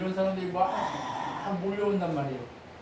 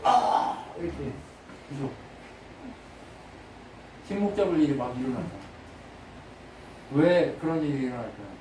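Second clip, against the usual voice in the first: normal talking voice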